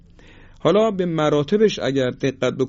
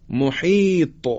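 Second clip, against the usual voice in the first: ordinary talk, nothing marked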